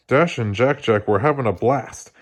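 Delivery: plain reading voice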